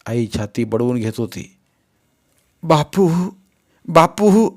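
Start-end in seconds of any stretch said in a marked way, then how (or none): 2.6-4.5 loud, high wailing cry. A narrator's reading. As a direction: wail the name through tears. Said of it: "करत रडत ओरडत होती". (रडत ओरडत हाक)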